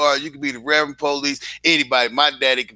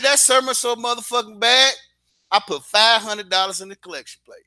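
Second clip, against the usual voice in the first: excited; 9 semitones higher than usual. Retold or imitated. Daddy mocking the sermon.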